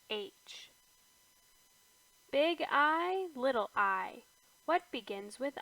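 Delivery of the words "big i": plain reading voice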